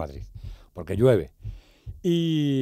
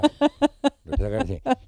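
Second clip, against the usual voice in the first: laugh. Real, not acted. Remembering funny toothless friend.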